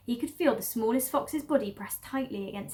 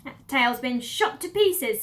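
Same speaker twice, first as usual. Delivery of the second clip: slow, louder scornful lady voice; bossy mistress; 2 semitones higher, 7 dB louder, slower than usual. Acted. Lady upstairs scolds the cellar woman, slow and scornful. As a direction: snap down the stairs, slow and loud. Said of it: "said the voice from upstairs".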